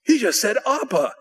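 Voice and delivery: high-pitched astonished report; amazed realization. Retold or imitated. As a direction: tell it in delighted disbelief. Retelling amazed reaction to wife.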